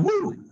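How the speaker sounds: high joyful whoop of relief; excited whoop. Real, not acted. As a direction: let out a big celebratory woo. Whoop after finishing hard set.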